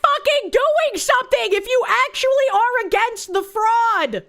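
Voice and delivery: yelling in frustration; high, loud exasperated yell; angry shout. Real, not acted. Angry outburst at representative.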